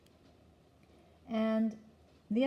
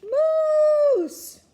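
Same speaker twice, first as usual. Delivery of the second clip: very high, very loud long shout; full-volume startled scream; yelled; 14 dB louder than usual. A narrator's reading. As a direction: holler it in shock at the sight. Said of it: "opened them up and yelled".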